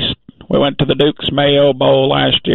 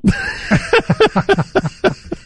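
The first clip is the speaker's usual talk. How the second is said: laugh. Real, not acted. Laughs at own joke.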